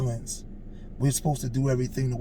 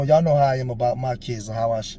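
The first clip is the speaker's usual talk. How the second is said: normal talking voice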